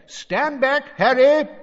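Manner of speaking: high, loud command; shouted warning. A narrator's reading. Mister Weasley warning Harry to retreat, loud and high. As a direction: call out a warning before a blast.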